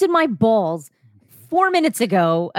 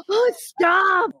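shocked laughing protest; 10 semitones higher than usual. Real, not acted. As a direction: yelp it out, scandalized. Embarrassed reaction to reading.

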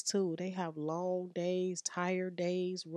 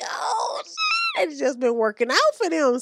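squealed, far higher and louder; shrieking amazement; 11 semitones higher, 9 dB louder than usual. Retold or imitated. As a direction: shriek like you can't believe it. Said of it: "And I'm like".

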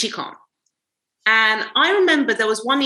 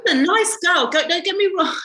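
laughing; 8 semitones higher than usual. Real, not acted. Laughing fake backpedal.

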